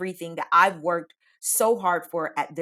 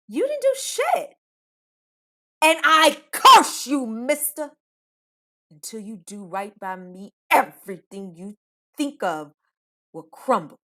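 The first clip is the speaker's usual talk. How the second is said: exploding in anger; 6 dB louder than usual. Retold or imitated. Cursing the coworker who stole the credit, exploding then ominous. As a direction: lose it at the coworker, then lay a spooky curse. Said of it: "I legit exploded in that moment".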